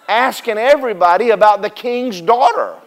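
usual speaking voice

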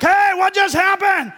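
loud, high, slow shout from the car; yelling. Retold or imitated. Yelling from the car to a guy on the street. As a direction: yell the question across the street. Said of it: "I yell at some guy".

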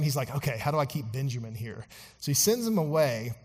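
ordinary talk

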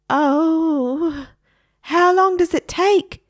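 high, loud whiny parrot; a parrot nagging outside the bedroom, high and loud; impatient parrot. A narrator's reading. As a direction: whine like a bored kid.

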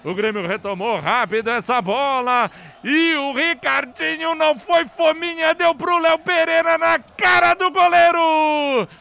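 high, loud celebratory recap; excited goal recap. Real, not acted. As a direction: relive the goal, shouting. Goal replay narration. (narração empolgada do gol)

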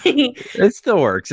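very high, slow joking protest; mock squeaky insistence. Joking about medication side effects, high and slow. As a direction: squeak it out slowly, defensive.

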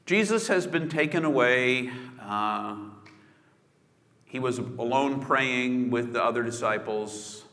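ordinary talk

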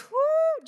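imitated high excited whoo; high whoop. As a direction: let out a high happy whoo. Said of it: "just like that".

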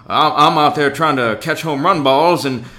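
lightly imitated loud southern guy, slow at the end; southern drawl imitation. Mocking the loud fan who sat behind us, in a lightly imitated southern voice. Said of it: "so he sounded like".

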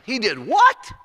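high, loud disbelieving question; can't believe what he heard; shocked. Retold or imitated. Boss learns of the half-price discount, shocked.

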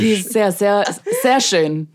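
slow, stretched German in a mock accent; drawn-out sehr, teasing; hammed-up German praise of a handsome actor. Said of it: "German Doge".

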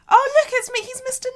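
high, loud gushing outburst; mock excited squeal. Mock delight at his photo.

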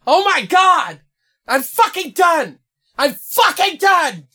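high-pitched mock freak-out; hammed-up high yell of outrage; imitating girlfriend yelling. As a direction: imitate her storming off, voice pushed way up high. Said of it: "yelling at me".

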